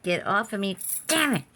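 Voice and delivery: loud, high yelp swatting at a fly; real freaked-out shout at a fly; panicked yell. Real, not acted. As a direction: shriek it off at the bug. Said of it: "I'm freaking out".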